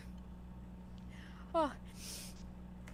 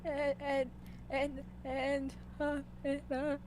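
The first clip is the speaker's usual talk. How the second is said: nervous stammer; 8 dB quieter, slower than usual. Acted.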